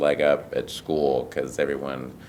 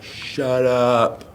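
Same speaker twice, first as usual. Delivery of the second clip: put-on higher, louder child's snap; five-year-old daughter; 8 semitones higher, 5 dB louder than usual. Daughter tells her dad to shut up, high and loud. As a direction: do the little girl telling dad off. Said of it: "she's like".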